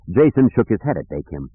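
ordinary delivery, nothing marked

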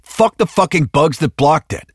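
high-pitched angry outburst; snapping. A narrator's reading. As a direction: spit it out in exasperation. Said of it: "Hicks snapped".

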